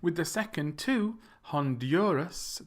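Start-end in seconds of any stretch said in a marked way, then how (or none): 1.4-2.6 Honduras as hon-DURE-us; slow, stretched DUR in Honduras; middle-syllable stress. Shown as a demonstration. Teacher modelling for learners, middle of Honduras stressed. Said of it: "the stress is in the middle".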